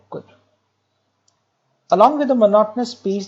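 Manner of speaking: ordinary delivery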